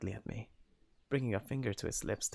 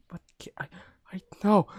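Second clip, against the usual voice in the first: whisper; 9 semitones higher, 8 dB louder, faster than usual. Acted. Whispered fluster.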